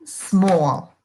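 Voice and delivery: "Small" in one unbroken beat, no vowel before s; single syllable. Shown as a demonstration. Teacher explaining the initial S, "Small" said as one syllable. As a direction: glide from s into m in "Small". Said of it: "es parte de la misma sílaba".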